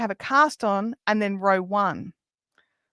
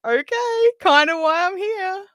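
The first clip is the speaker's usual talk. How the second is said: mock exasperated; 10 semitones higher, 6 dB louder, slower than usual. Her retort to the trainer.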